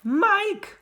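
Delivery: very high, loud cry; frantic mother's high call; cried. A narrator's reading. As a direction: call out frantically to your son. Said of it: "cried Missus Teavee".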